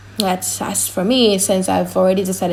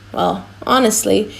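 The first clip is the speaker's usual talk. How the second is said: normal talking voice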